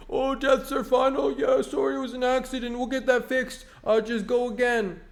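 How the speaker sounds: high-pitched, put-on Blizzard rep voice; mock company rep. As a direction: brush the player off in a silly high voice. Mimicking Blizzard's dismissive reply.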